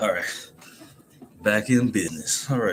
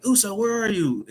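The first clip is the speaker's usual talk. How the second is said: mock calling out; 12 semitones higher than usual. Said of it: "she's like".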